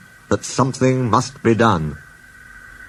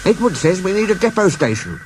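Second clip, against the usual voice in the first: small engine voice; 8 semitones higher than usual. Acted. Percy voiced high.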